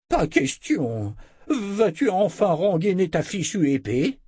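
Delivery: high-pitched, put-on old man's protest; exasperated old wizard. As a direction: refuse in a drawn-out, cranky elder's voice. Old wizard refuses at sword point. (vieux sorcier exaspéré)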